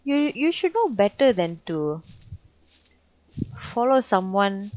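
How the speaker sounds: ordinary talk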